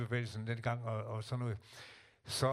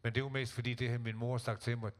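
normal talking voice

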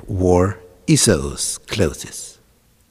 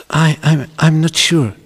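stammering, high and louder hesitation; unsure son; 6 semitones higher, 5 dB louder than usual. Acted. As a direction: balk nervously in a raised voice. Hesitant son answers mother.

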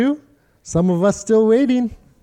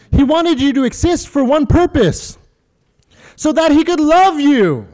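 rising preaching; 6 semitones higher, 3 dB louder than usual. Acted. Preacher builds to climax.

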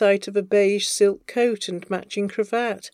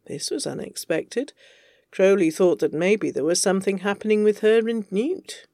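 ordinary delivery

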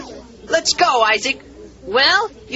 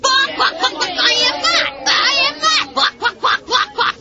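high, taunting chicken squawk; jeering high-pitched hen imitation; mocking chicken clucks; 9 semitones higher than usual. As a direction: cluck like a chicken to jeer at a coward. Bully mocks boy as chicken.

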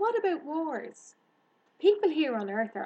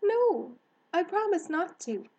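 high, loud, anxious rush of explanation; urgent warning; 6 semitones higher, 6 dB louder than usual. Acted. Girl warns hare about harvest shooting, urgently. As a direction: warn a friend earnestly.

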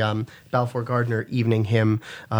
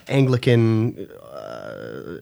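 glottal fry; 6 semitones lower, 4 dB quieter, much slower than usual. Shown as a demonstration. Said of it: "I'm just gonna do glottal fry some more".

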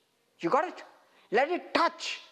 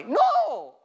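sharp, high, loud No!; emphatic no; 13 semitones higher, 5 dB louder than usual. Real, not acted. Preacher refusing a listener's doubt, loud high No!. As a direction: shut the objection down hard. Rejecting the congregation's objection.

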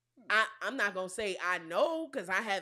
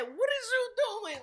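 high-pitched indignant question; mock indignation; 10 semitones higher than usual. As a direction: act out the partner's outraged disbelief. Acting out partner's indignant reaction.

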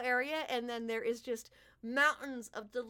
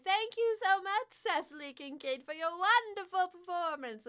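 higher, louder showman's thank-you to the crowd; grand fey king; 8 semitones higher, 3 dB louder than usual. Acted. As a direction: announce it like a ringmaster king.

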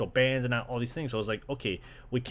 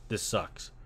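flat disappointed mutter; 4 semitones lower, 7 dB quieter than usual. Retold or imitated. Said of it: "I'm like".

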